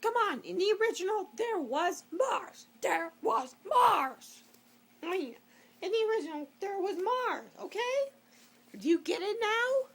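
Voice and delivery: high, loud exasperated rant; indignant rant. Real, not acted. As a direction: hammer home the point. Ranting about the original.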